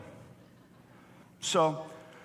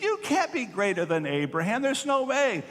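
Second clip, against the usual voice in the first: rising to a much higher pitch on "There's no way"; incredulous crowd; 9 semitones higher than usual. Retold or imitated. Angry crowd scoffs at Jesus's claim, incredulous and high.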